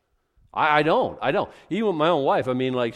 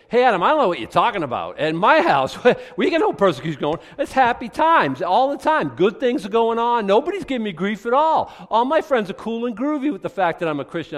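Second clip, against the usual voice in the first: higher, louder, fast and breezy mock voice; hammed-up carefree listener brushing it all off; mocking complacent churchgoer; 9 semitones higher, 9 dB louder than usual. Pastor mimics a churchgoer who denies persecution, mock-cheery. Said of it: "And now you're saying".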